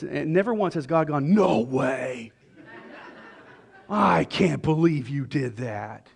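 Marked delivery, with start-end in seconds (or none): 1.3-6.1 slow, drawn-out put-on disbelief; mock shock. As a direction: pretend to be aghast, stretching out the words. Mock shocked God in a retelling.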